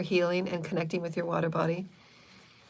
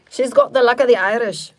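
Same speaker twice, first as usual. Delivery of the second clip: loud, higher mock Irish accent slipping into British; British-sounding Irish attempt; 4 semitones higher, 8 dB louder than usual. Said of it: "I think that's British".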